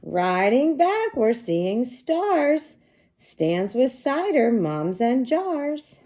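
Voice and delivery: high, loud, slow storybook read-aloud; sing-song read-aloud for young kids; reading to children. Acted. Teacher reading the book aloud to her class, slow and bright.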